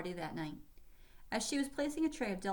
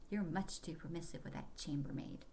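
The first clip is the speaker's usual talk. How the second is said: muttered aside; 2 semitones lower, 6 dB quieter than usual. Acted. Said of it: "Hannah heard him mutter".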